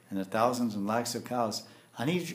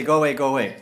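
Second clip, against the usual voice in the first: put-on grumpy old man; 3 semitones higher, 8 dB louder, faster than usual. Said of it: "grumpy old men".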